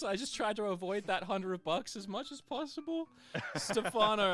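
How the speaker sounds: normal talking voice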